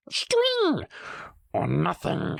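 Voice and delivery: very high shriek cut off; high piercing Gollum cry. Acted. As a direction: shriek it out as the creature. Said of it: "shrieked Gollum".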